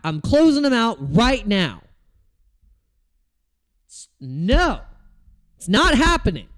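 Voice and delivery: heated outburst at his trades; angry. Real, not acted. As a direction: vent real frustration at the market. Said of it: "the anger in my voice".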